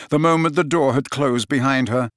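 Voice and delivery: plain reading voice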